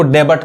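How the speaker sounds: डेबट said "de-bat", closing on t; wrong pronunciation. Shown as a demonstration. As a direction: end डेबट on a hard T, no "yu". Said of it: "इसको बहुत लोग गलत पढ़ते हैं".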